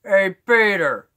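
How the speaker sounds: loud, drawn-out, high holler; Joe. Shown as a demonstration. Streamer calling Peter as the cartoon neighbor, loud Joe voice. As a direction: holler it out and stretch it. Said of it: "I can do Joe".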